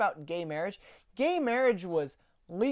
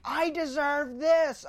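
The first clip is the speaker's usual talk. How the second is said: slow, higher mock shout of a protester; 6 semitones higher, 3 dB louder, slower than usual. Mimicking lefties shouting demands, slow high mock shout. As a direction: drag it out loud and high, mocking the chant. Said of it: "just shouting like".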